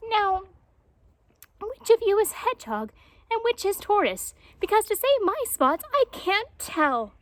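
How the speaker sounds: high, eager, slightly bossy jaguar; puzzled jaguar pressing for an answer; Painted Jaguar. A narrator's reading. Jaguar questions hedgehog and tortoise, high and bossy.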